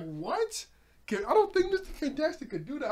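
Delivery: loud, high-pitched incredulous reaction; stunned disbelief. Retold or imitated. Recalls his stunned reaction.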